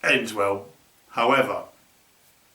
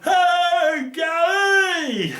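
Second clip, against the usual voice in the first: much higher, louder shout of Hey Gary across the lake; calling out; 3 dB louder, much slower than usual. Retold or imitated. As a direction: hail your mate on the far bank at full voice.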